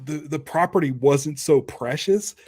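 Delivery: ordinary talk, nothing marked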